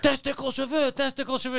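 high-pitched shouting in broken French; screaming complaint. Retold or imitated. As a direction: yell it like an outraged man mangling French. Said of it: "and he's screaming at them".

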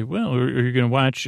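ordinary delivery

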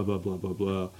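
ordinary talk, nothing marked

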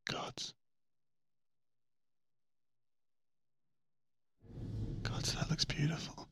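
breathless, hushed wonder; awed whisper. Real, not acted. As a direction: whisper it in awe. Awed at Jupiter view.

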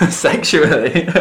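laughing, drawn-out disbelief. Real, not acted. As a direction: laugh the word out slowly.

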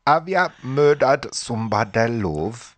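a hammed-up attempt at Vera's Geordie lilt; Vera Geordie impression. Pushed by Paul on the podcast, a half-hearted mock Geordie Vera. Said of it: "Go on, do her".

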